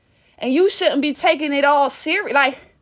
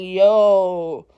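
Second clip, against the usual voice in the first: lower, weary "Yo, Lord"; exasperated sigh-words; 8 semitones lower, 3 dB louder than usual. Retold or imitated. Fed up with touchy people, low exasperated groan.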